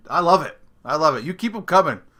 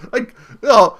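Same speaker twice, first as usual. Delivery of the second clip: usual speaking voice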